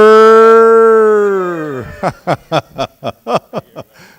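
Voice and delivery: loud, high burst of laughter; big laugh. Real, not acted. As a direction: let out a big whooping laugh. Big laugh after chant.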